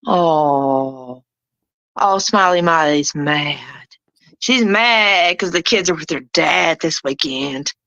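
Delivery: low, drawn-out taunting coo; teasing, put-on pouty sympathy; mock pity. Mocking an angry rival with fake pity.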